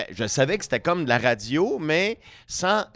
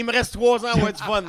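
mock panic; 10 semitones higher than usual. Mock panic joking about age.

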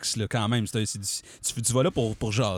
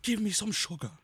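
mock British accent; 6 semitones higher, 7 dB quieter than usual. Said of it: "accent britannique".

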